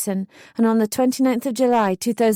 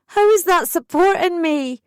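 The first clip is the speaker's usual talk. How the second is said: furious high shout down the phone; screamed; 8 semitones higher, 4 dB louder than usual. A narrator's reading. Daughter screaming at her mum over the phone, furious shout. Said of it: "I screamed down the phone".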